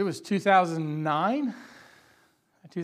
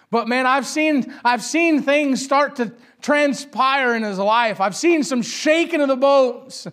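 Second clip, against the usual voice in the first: slow preaching build; 6 semitones higher, 7 dB louder, slower than usual. Real, not acted. Preacher slows to build intensity.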